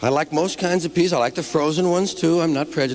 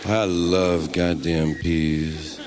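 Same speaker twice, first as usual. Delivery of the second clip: low, slow stoned drawl; stoned guy; 6 semitones lower, much slower than usual. Acted. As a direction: stretch every word, deep and dazed. Stoner character holds the bit.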